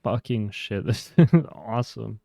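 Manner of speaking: ordinary talk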